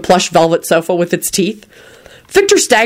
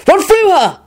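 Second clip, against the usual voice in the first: high, louder urgent yell; urgent shout; 14 semitones higher, 4 dB louder than usual. A narrator's reading. William urging Rosie onward after the scream, shouting. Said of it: "William shouted".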